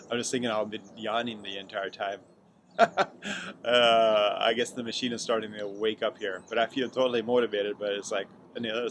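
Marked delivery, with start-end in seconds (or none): 2.8-3.6 short high sleepy laugh; burst of laughter. Real, not acted. Laughs at own yawning.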